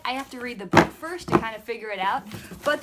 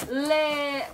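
French accent; much slower than usual. Shown as a demonstration. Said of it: "So you'd say refrigerator".

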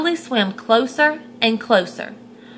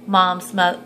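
plain reading voice

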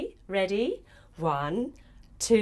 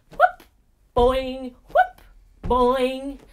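hopping noises; 7 dB louder, much slower than usual. Acted. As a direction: make loud bunny-hop sound effects. Hopping sound effects for children.

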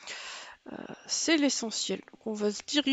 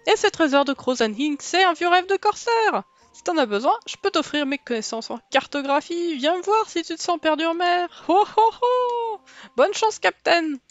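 hearty higher, louder pirate laugh and talk; 4 semitones higher, 6 dB louder than usual. Acted. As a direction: ham up a jolly old sea dog. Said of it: "je sais pas faire les voix de pirate".

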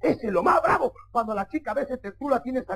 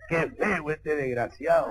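slow, low contempt; low mock disdain; disgusted elder brother; 8 semitones lower, 3 dB quieter, slower than usual. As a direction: drag it out as the hostile brother. Hostile elder brother eyes the suitor.

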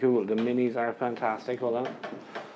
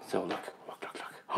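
hushed, eager whisper; 3 semitones lower, 11 dB quieter, slower than usual. Acted. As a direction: whisper it like a big reveal. Hushed reveal of the box.

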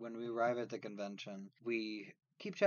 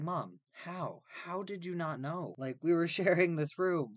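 incredulous; 6 semitones higher, 4 dB louder than usual. Retold or imitated. Retold incredulous questioning of his mother.

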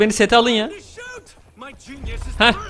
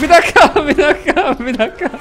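panicked laughing; 7 semitones higher, 6 dB louder than usual. Real, not acted. Stuck in the open under fire.

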